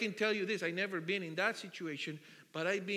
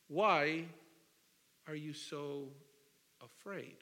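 gentle question; 4 semitones lower, slower than usual. Retold or imitated. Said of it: "He said to his disciples".

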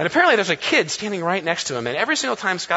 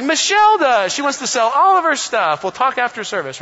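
higher and louder, playful cheer; mock excited announcement; 7 semitones higher, 6 dB louder than usual. Pastor teasing the interpreter from the pulpit, mock excited. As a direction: point her out with a big grin. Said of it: "And I was like".

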